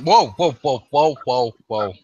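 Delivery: acted plea to hold on; alarmed placating. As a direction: back someone off with rapid protests. Acts man with hands up.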